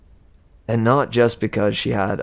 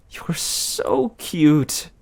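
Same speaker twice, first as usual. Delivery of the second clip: doting goddess; 5 semitones higher, much slower than usual. Acted.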